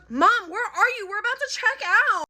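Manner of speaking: imitating a bratty kid calling mom; bratty demand. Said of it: "In that exact tone".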